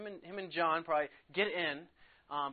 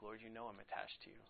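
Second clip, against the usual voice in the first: quietly brokenhearted, much softer; a touch of heartbreak, hushed; 4 semitones lower, 9 dB quieter than usual. Retold or imitated. Peter answers Jesus the third time, softly brokenhearted. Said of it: "Peter was brokenhearted".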